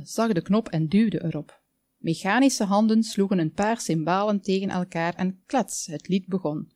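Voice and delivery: ordinary delivery, nothing marked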